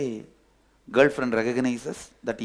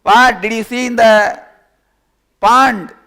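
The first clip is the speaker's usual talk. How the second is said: high, loud demanding questions; loud challenge; 7 semitones higher, 11 dB louder than usual. Acted. One animal loudly challenges the other. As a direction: fire the questions at him.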